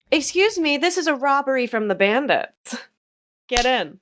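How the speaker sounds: high-pitched mock hold-up; playful bandit. Daisy pulls down her bandana at the car window, high mock-bandit call.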